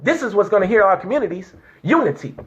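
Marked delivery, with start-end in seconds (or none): none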